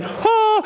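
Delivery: high, held "ah" on coming up; imitated surfacing cry. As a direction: burst out of the water with a high "ah". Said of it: "you would expect me to be like".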